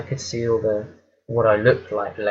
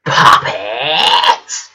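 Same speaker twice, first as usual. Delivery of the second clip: loud, shrieking monster cry; monster roar; 9 dB louder than usual. Retold or imitated. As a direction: bellow it like a demon spotting prey. Monster roars at her in dream.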